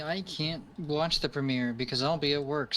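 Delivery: ordinary talk, nothing marked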